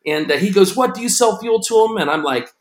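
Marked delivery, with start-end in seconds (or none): 0.8-2.0 high-pitched startled question; retold amazement, pitched up; imitating freaking out. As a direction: play a mildly freaked-out caller. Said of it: "he's just freaking out".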